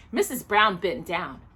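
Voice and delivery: ordinary delivery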